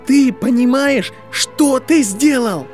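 slow, high, very loud shout; furious scream. A narrator's reading. Draco grabs Harry by the collar, furious scream. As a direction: scream each word out, drawn long. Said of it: "Его голос перешёл на крик, невыносимо громкий".

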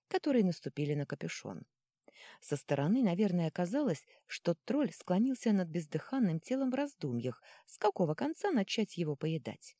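ordinary delivery

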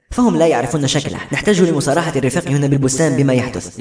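ordinary delivery, nothing marked

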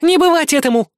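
high, loud defiant cry; defiant shout. Acted. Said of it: "закричала Кикимби". (дерзкий крик)

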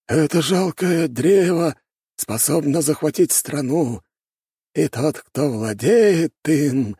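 high, slow elder voice; scheming old master. A narrator's reading. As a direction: draw it out in a high, insinuating voice.

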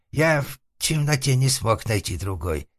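high voice of a meek man; timid excuse. Acted. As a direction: excuse yourself meekly. Затворник оправдывается. (робкое высокое оправдание)

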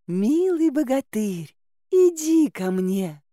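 high, slow alluring call; coaxing maiden. Acted. Maiden calls the dear bogatyr to her, coaxing. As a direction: lure him with drawn-out, lilting words.

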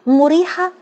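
high-pitched, sceptical query; incredulous echo. A narrator's reading. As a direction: throw the word back as a disbelieving question. Echoes a word, disbelieving.

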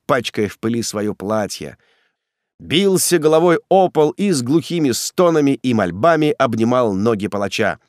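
plain reading voice

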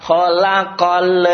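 "khalaqal" with a stray E after the final l; mistaken added E. Retold or imitated. Teacher imitates a funny mistake, "khalaqal" with a tacked-on E. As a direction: let "khalaqal" spill into an extra "le" at the end. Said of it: "Nah, ada E-nya".

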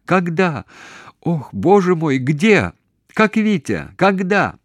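very high, urgent loud questions; shouting. A narrator's reading. Daughter asks mother about the family, shouting urgently. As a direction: cry out anxiously down the line. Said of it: "кричала Таня".

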